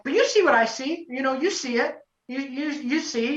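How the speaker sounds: high, tentative appeal; mild pleading. Retold or imitated. Contestant urging judge to see it too, lightly pleading.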